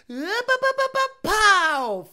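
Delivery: high, loud ba-ba then a pow; imitated revs and bang. Mimicking the guy's engine revving then blowing up, loud high. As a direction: rev it up then blow it. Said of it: "where he's like".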